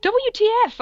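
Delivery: very high, loud outburst; shouted angrily. A narrator's reading. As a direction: yell the letters in high-pitched outrage. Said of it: "I shouted angrily".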